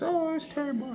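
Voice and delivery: very high, wailing mock cry; mock sobbing. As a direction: ham up a tearful wail. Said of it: "She starts crying like this".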